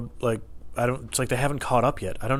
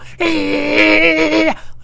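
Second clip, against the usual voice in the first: loud, high squeal through bared grin; fake angry smile; 10 dB louder than usual. Employee grinning into the customer's face, fake angry squeal. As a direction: force out a long mock-manic noise through a smile. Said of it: "fake, angry smile".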